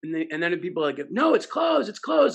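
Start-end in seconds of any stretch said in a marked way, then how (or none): 1.1-2.4 high, loud shouted correction; audience yelling. Retold or imitated. Audience yells at the CEO, loud correction. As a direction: yell it from the crowd. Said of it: "They're all yelling".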